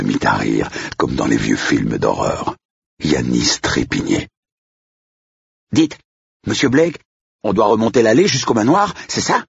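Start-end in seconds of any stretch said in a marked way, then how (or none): 5.7-9.4 put-on high-pitched kid asking; high little questioner; child's voice. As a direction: pipe up like a curious child.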